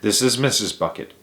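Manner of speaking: ordinary delivery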